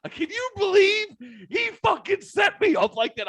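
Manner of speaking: mock whiny kid. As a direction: squeak it like an outraged kid. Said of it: "Your dad was like".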